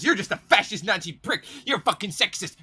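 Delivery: high-pitched mocking whine; mock ranting. Vlogger mimicking people who call everyone fascist, mock ranting. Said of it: "And people will go".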